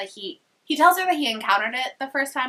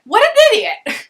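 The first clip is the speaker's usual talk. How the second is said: much higher and louder, a squealed put-down; mocking jab; 11 dB louder than usual. Real, not acted. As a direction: squeal the insult in a cartoon voice. Narrator mocks Imogen.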